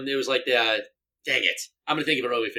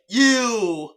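mock blame; 10 semitones higher, 4 dB louder than usual. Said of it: "I immediately was like".

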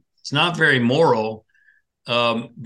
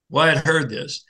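ordinary delivery

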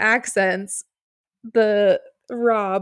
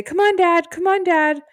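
high, louder daughterly urging; encouraging daughter; 9 semitones higher, 4 dB louder than usual. Retold or imitated. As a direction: imitate a daughter pushing her dad on. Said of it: "I really need her encouraging me".

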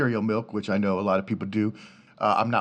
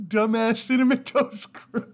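high, slow, cracking up; laughing insult; 10 semitones higher than usual. Real, not acted. Host mocking the mascot while laughing hard. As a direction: squeeze the words out through laughter.

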